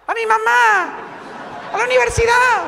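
high, silly put-on voice; mocking someone's answer. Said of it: "decía alguno".

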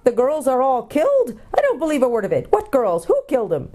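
high, disbelieving dwarf voice; indignant deaf dwarf. A narrator's reading. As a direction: demand answers, having misheard everything.

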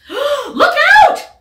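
shrieked high and loud warning; panicked squawk. Acted. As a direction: scream a frantic warning in a duck's squawk. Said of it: "squawked Missus Mallard, all of a dither".

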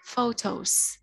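photos said with a clear S at the end; final s sound. Shown as a demonstration. Teacher modeling for her child: photos with a clear final s. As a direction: model photos ending in a crisp s. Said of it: "Đối với từ photo thì con đọc có âm S ở phía cuối".

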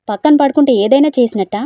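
loud irritated comeback; annoyed shout. Acted. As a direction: snap back at her. Annoyed retort in quarrel.

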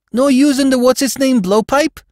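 high, loud disbelieving question; astonished New York crook; incredulous. Acted. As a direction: ask it aghast, pitch way up. Crook stunned by boss's retirement.

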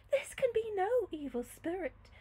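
usual speaking voice

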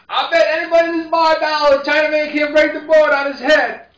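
put-on high, loud drunk egging on the crowd; drunk bettor. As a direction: bellow the bet to the whole bar.

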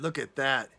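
usual speaking voice

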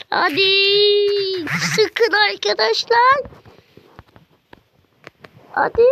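high, loud desperate urging; real game tension, almost pleading; tense urging. Real, not acted. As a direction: push them on as the match tightens.

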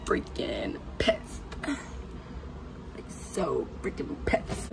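slow, low whispered grumble; whispered frustration. Real, not acted. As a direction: hiss it under your breath, fed up. Hushed repeat of complaint.

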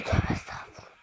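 whispered under breath. Real, not acted. Muttering after a near miss.

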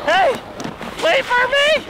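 ordinary talk, nothing marked

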